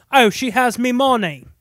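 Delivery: loud Cockney impression; Cockney accent. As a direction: do McDowell's Cockney. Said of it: "That's what Malcolm McDowell sounds like".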